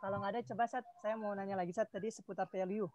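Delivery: faint and distant; speaking too far from the mic. Real, not acted. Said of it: "kayaknya kok suaranya kecil ya".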